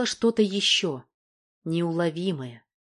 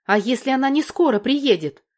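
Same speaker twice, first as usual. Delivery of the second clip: high, louder anxious question; worried outburst of a question; exclaiming; 7 semitones higher, 6 dB louder than usual. A narrator's reading. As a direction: raise pitch and volume in protest. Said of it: "воскликнула Валька".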